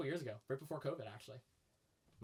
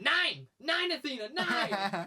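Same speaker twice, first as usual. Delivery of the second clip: loud, high imitated German commands; retold screaming; 10 semitones higher, 7 dB louder, much slower than usual. Uncle Josh scolding misbehaving shepherd at camp, mock screaming. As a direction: yell like an uncle scolding his dog.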